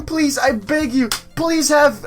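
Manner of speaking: usual speaking voice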